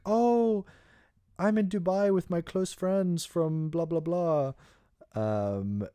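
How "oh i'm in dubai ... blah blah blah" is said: much higher, breathy-free gushing mock voice; mock influencer girl. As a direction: squeal it like a fake-sweet Instagram girl. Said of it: "girls that are saying, like".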